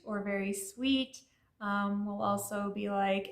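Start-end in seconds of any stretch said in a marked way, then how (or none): none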